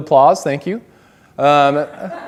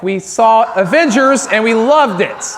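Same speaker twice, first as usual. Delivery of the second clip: gushing; 8 semitones higher, 7 dB louder than usual. Retold or imitated. Gushing about the movie.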